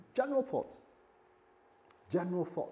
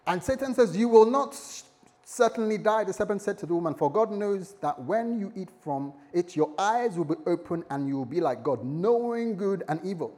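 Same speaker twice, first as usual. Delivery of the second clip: higher, louder fervent Bible reading; scripture reading; 4 semitones higher, 5 dB louder than usual. Acted. Preacher reading Genesis to the church, fervent and louder. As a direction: proclaim the verses.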